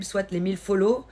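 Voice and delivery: ordinary delivery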